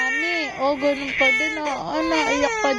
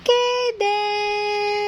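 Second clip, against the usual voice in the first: long, high-pitched name call; singsong call; 4 semitones higher than usual. Real, not acted. Calling out to Kaden.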